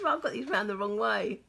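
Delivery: ordinary talk, nothing marked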